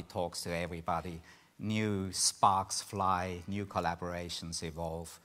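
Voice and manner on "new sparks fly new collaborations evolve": ordinary talk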